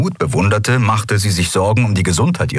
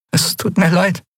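high, small, faltering voice; choked-up apology; 12 semitones higher than usual. A narrator's reading. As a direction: apologise as if unable to go on. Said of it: "Sie konnte nicht weitersprechen".

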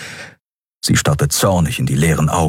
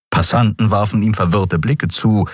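ordinary delivery

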